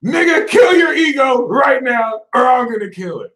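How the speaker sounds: loud, high threatening shout; mock-threatening yell; Scorpio threat. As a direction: bark it like a blunt Scorpio. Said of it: "Scorpio's gonna be like".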